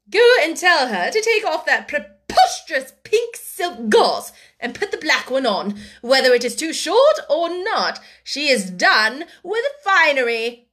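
loud, emphatic, scornful command; irate, forceful dismissal; angry order. A narrator's reading. Angry woman ordering her sister.

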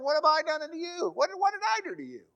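high, fast, pleading donkey voice; hurt donkey asking what it did wrong; talking donkey. Acted. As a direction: squeak it out like a wounded donkey. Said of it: "the Lord opened the mouth of the ass".

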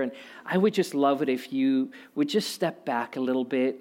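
put-on soft, syrupy plea to a child near a cliff; overly sweet dad. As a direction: coax him gently, far too nice. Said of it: "I didn't say".

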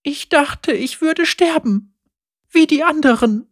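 she stutters, still shaken; girl admitting failure to Lucian. A narrator's reading. Girl nearly killed.

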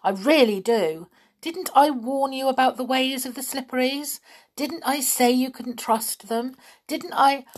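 high-pitched cross scolding. A narrator's reading. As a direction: pile up indignant questions. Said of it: "said Binky crossly".

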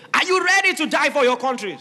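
loud high commander's cry; army commander rallying his soldiers loudly; rallying shout. Acted. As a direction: rouse the troops before battle.

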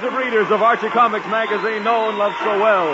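high, loud show-opening flourish; big radio announcer introduction. Acted. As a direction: belt out the show title, drawing out the name.

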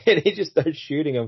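usual speaking voice

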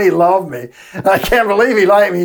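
ordinary talk, nothing marked

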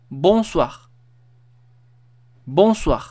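"Bonsoir" en francés, con la o nasal y "swa" al final. Shown as a demonstration. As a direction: decir "Bonsoir" pausado, como modelo. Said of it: "el bonsoir". (pronunciación modelo de "Bonsoir")

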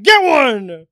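telling the coworker off, loud and curt; retold snap. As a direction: bark it back at the box thief. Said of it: "And I'm like".

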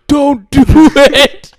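high, loud, slow dramatic plea; mock warning. Cohost silently warns host off an accent, high slow plea. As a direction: beg him to stop, hammed up. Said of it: "and you're like".

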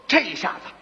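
ordinary delivery, nothing marked

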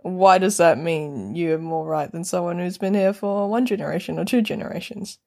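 ordinary delivery

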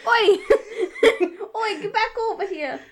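bad Brooklyn tough-guy voice; hammed-up Brooklyn street shout; Brooklyn accent. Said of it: "shitty Brooklyn accent".

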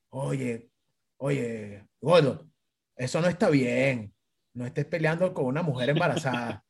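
low, quiet admonishment; retold grave reproach. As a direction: imitate a friend solemnly taking him aside. Friend's grave scolding, retold. (regaño grave y bajito, imitado)

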